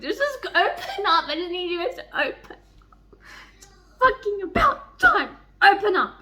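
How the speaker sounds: high, loud insistent pleading; pleading urge. Real, not acted. Reader begging the couple to talk, high and loud. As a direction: beg them to open up. Urging characters to confess.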